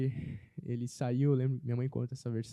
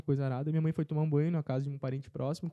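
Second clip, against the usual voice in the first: ordinary talk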